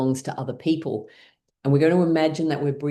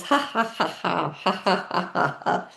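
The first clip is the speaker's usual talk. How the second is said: forced laughter; 4 semitones higher than usual. Shown as a demonstration. Teacher leads class in laughter exercise, high laughing. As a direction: laugh it out on purpose, pitched up high. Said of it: "we're gonna do it with laughing".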